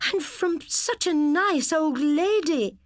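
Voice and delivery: high, loud, slow shocked cry; horrified at her brother; shocked gasp. Acted. Sister catches brother stealing, shocked high cry.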